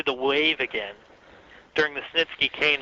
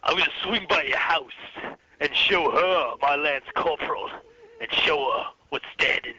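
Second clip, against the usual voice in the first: Triple H impression. Host doing Triple H threatening Slaughter, put-on wrestler voice. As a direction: growl it like a heel cutting a promo. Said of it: "I did an impression one time".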